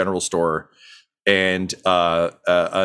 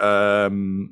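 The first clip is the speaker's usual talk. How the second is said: ordinary talk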